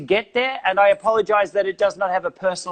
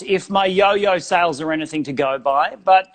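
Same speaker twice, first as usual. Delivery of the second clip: normal talking voice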